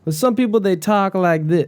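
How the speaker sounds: high-pitched put-on Southern accent; shaky attempt at Southern accent. Shown as a demonstration. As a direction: demonstrate a Southern accent, not quite landing it. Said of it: "You sound like Brian Callen".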